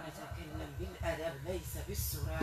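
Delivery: distant, hushed possessed voice; thick strong voice. Real, not acted. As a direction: murmur it like a spirit speaking through her. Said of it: "بصوت غليظ قوي".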